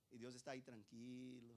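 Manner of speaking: low, soft, unbothered delivery; calm. Acted. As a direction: drop right down after the outcry. Contrasts calm God with shouting people.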